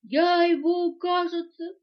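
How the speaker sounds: high, loud tearful wail; sobbing. A narrator's reading. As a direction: break the words up with sobs. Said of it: "всхлипнула мартышка". (всхлипывая)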